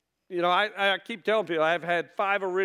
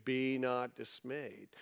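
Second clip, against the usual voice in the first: gentle reassurance; 6 semitones lower, 11 dB quieter, slower than usual. Acted. God's comforting word, said softly.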